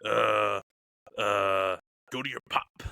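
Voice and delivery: a hint of a gravelly wrestler drawl; low, halting wrestler impression; lightly voiced Macho Man. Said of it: "But he's like".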